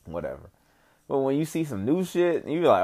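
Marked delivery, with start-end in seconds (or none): 0.0-0.5 offhand, trailing-off reaction; acted indifference; unimpressed. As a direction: shrug it off, dropping away. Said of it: "when you see something you used to".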